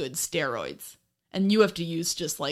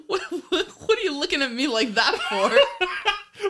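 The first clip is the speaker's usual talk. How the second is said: laughing; 10 semitones higher, 3 dB louder than usual. Real, not acted. Teased about blushing.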